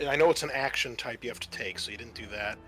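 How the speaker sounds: ordinary delivery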